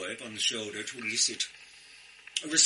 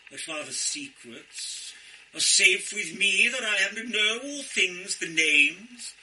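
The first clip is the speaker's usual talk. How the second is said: higher, louder, grandly jolly Santa; hammed-up Father Christmas; 7 semitones higher, 6 dB louder than usual. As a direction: puff up into a booming, jolly Santa. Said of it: "I found myself flinging myself into the role".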